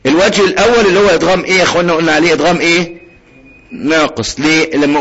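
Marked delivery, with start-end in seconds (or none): none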